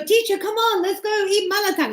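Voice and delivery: high, pleading student; eager student voice. Retold or imitated. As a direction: coax the teacher like an eager student. Voicing students inviting teacher.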